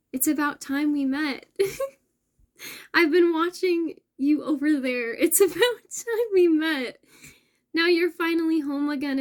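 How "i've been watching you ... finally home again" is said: high, loud, laughing through tears; tearful laughing. Real, not acted. Moved by song lyric to viewers, tearful laugh. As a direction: choke up while laughing. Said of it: "this is a very emotional moment".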